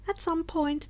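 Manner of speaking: plain reading voice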